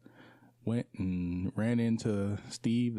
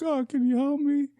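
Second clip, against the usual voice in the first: much higher, louder pleading voice; pleading stall guy; 6 dB louder than usual. Retold or imitated. Stranger in the stall begging him for help, high and pleading. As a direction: squeak it out like a desperate stranger.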